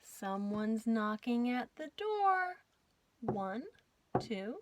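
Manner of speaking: ordinary delivery, nothing marked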